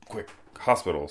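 usual speaking voice

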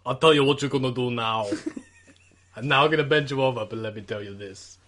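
low, heavy Austrian-accented impression; Arnold Schwarzenegger. Player giving an accent clue to friends, low Austrian impression. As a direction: drop deep and measured, like an Austrian action star. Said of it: "is that Arnold Schwarzenegger?".

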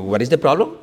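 lightly imitated Indian accent, questioning lilt; imitated Indian English. Indian brother answering a traveller's complaint, lightly imitated Indian accent. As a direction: mimic an Indian man's English, rising at the end. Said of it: "cuando yo estaba en la India".

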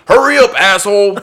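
loud, high, impatient yell; yelled audition line. Acted. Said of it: "I say it like that".

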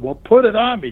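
daring him to prove it; challenging. Retold or imitated. The trainer daring the rookie to show him, challenging.

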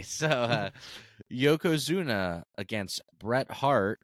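ordinary talk, nothing marked

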